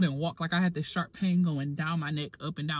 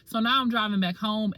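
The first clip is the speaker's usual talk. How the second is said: ordinary talk, nothing marked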